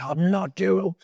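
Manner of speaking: indignant refusal. Retold or imitated. Patient recalling his reaction to little annoyances, indignant.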